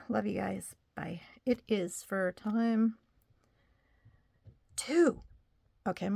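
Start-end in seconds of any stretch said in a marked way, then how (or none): 0.1-1.2 soft whispered goodbye; whispering. Real, not acted. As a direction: breathe it out, no voice. Whispered sign-off to viewers.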